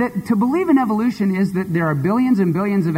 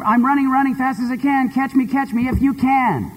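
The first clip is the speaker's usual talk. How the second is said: higher, sing-song taunting chant; a light, higher gingerbread man voice; 8 semitones higher than usual. Acted. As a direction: tease like a runaway cookie. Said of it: "he says".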